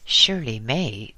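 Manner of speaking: usual speaking voice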